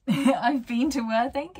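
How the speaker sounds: laughing. Real, not acted. Embarrassed after knowing little.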